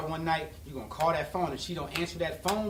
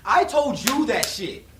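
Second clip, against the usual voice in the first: retold angry yell; 8 semitones higher, 6 dB louder than usual. Scolding the friend who told his girl, retold angry yell. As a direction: chew him out for snitching to his girl.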